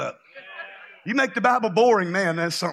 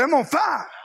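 higher, fired-up declaration; really on fire; 8 semitones higher than usual. Shown as a demonstration. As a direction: say it like you truly burn with it. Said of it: "if you was really on fire".

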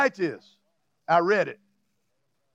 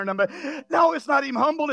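ordinary delivery

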